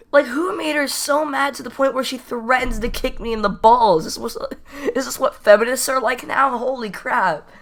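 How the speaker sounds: high-pitched baffled ranting; incredulous. Retold or imitated. Teller wondering why the girl threatened a kick, incredulous.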